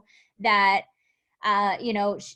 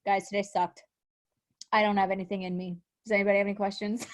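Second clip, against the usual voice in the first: mock low energy; faster than usual. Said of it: "I'm not bringing low energy".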